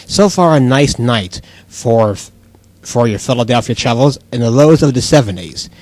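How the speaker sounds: low-pitched weather forecast read; radio weather report. Pretend. Weather reporter to Philadelphia travelers, radio weather report.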